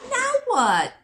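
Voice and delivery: high reindeer asking what next. Acted. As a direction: squeak it out as a puzzled reindeer. Reindeer asks.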